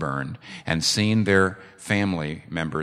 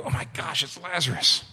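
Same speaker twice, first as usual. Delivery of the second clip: hushed, higher breathless whisper of shock; awed gasp; 8 semitones higher than usual. Retold or imitated. Brothers seeing dead Lazarus rise, stunned hushed whisper.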